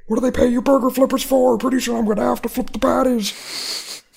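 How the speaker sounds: high, loud put-on griping voice; hammed-up outrage of an affronted customer; indignant. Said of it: "like, indignant about it".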